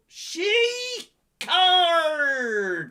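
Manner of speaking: high, loud, long-drawn cry; hammed-up drawn-out holler; mock exclamation. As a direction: stretch both words out in a big high shout. Exclaims name of the card.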